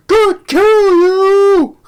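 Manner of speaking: high, loud, stretched yell; slow maniac screech at the kid; crazed villain yell. Retold or imitated. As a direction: scream it like a madman. Crazy villain quoted.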